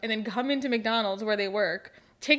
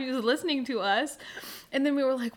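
ordinary delivery

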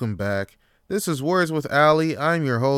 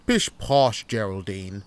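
hammed-up English accent, breezily dismissive; pompous English camper accent; put-on English accent; 6 semitones higher than usual. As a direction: brush off complaints in grand English tones. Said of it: "what the fuck is up with this English accent?".

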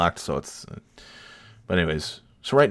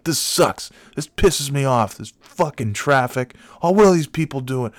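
higher-pitched imitation of a ranting kid; angry oldest child; 6 semitones higher than usual. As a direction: mimic the child's outburst, pitched up. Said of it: "the oldest child is like".

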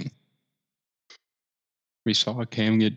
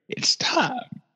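excited quote, pitched up at the start; retold excitement; 4 semitones higher than usual. Recalling a friend's words before the big game, excited. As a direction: retell a friend's hyped announcement.

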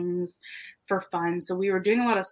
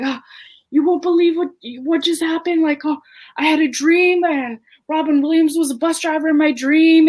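imitating a frantic friend, much higher and louder; frantic excitement; 9 semitones higher, 8 dB louder than usual. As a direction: blurt it out, beside yourself. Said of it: "going crazy".

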